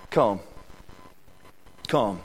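a low, soft "Come." let fall twice; hushed. Acted. Preacher to his church, hushing the word "Come.". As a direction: drop the voice right down on "Come.".

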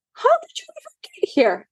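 very high, fast astonished question; shocked to find the kittens. A narrator's reading. Surprised driver finds kittens.

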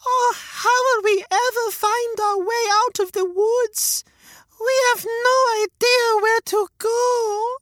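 put-on very high, slow frightened girl; a little girl close to tears; scared little girl. Gretel lost in woods.

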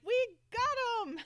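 high, loud, slow victory cry; triumphant shout. Retold or imitated. Lee cheering as the car crashes, high loud triumph. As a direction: cheer the win. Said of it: "he's like".

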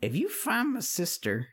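put-on weary, pleading old witch. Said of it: "And the witch says".

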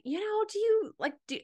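high, breezy suggestion; imagined naturopath voice. Retold or imitated. Naturopath suggesting dance, lightly voiced high and breezy. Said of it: "I could imagine her voice like this".

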